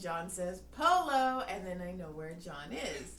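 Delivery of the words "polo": loud Marco Polo call; retold game call, loud. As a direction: call it across a store.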